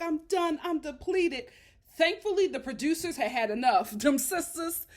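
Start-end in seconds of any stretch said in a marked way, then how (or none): 0.0-1.5 high, louder mock exhaustion; imitating fed-up wife. Paige telling Chris she's finished, high mock distress. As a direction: act out giving up, pitched up. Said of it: "And she's like".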